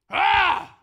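loud high growling roar. Shown as a demonstration. Pastor showing the congregation a growl, loud. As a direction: let out a big animal growl. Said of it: "can everybody growl with me".

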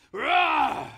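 big loud growling roar together. Shown as a demonstration. Pastor growls with everyone to show anger, loud. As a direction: roar with the whole room. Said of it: "can everybody growl with me".

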